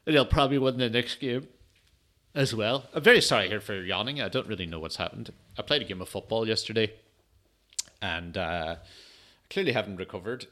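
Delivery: normal talking voice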